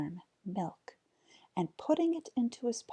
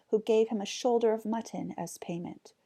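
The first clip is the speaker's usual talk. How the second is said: usual speaking voice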